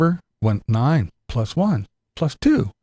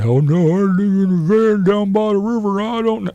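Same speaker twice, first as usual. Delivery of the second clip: higher, louder whiny excuse voice; mock excuse; 8 semitones higher, 5 dB louder than usual. Comedian mocking someone claiming no internet, with a loud whiny excuse. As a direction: whine like a fake-poor internet denier. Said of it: "And don't say".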